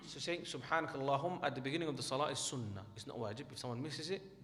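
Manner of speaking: ordinary talk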